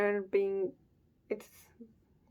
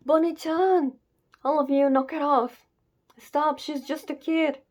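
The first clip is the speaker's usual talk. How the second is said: high, loud pleading cry to stop them; desperate shout; 6 semitones higher, 6 dB louder than usual. Acted. Shouting at the crowd to spare the child, desperate cry. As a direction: yell at them to leave the girl alone.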